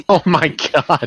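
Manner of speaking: laughing. Real, not acted. Laughing reaction.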